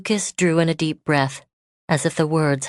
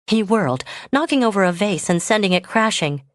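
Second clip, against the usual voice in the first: usual speaking voice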